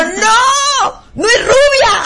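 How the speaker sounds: high, drawn-out laughing objection; mock protest. Host jokingly defending the radio announcer, mock protest. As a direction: protest loftily through laughter.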